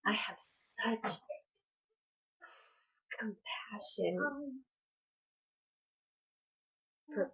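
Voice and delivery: hushed, choked-up words, slowed and fading to a whisper; holding back tears. Real, not acted. Mother on her video, close to real tears. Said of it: "I didn't want to cry".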